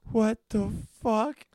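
high, slow, stunned; shocked high yell. Retold or imitated. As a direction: stretch it out in shock. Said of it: "And I'm like".

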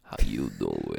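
low, slow, smooth come-on; very deep pickup line. Acted. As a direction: drop very low and croon. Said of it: "Joey pickup line".

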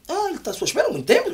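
high, fast, gushing attendant; school worker voice. Retold or imitated. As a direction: rattle it off like an eager school worker. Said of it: "a contínua diz".

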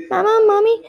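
child's scream, acted in a read-aloud. A narrator's reading. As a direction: call out for mommy in alarm. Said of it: "screamed Molly again".

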